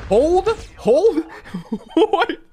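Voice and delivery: loud, very high exclamations broken by laughter; astonished shouting. Real, not acted. Streamer amazed by a good shotgun shot, astonished shouting. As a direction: shout in real amazement, laughing between words.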